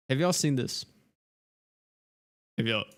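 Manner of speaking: hiccuping mid-sentence; hiccups. Real, not acted. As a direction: voice jolted by hiccups. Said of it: "I'm hiccuping".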